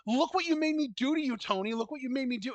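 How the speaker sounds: hammed-up blaming wail; imitating Mariah. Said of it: "Mariah's acting her fucking ass off, talking about".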